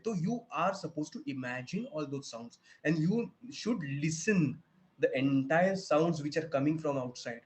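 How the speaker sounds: ordinary talk, nothing marked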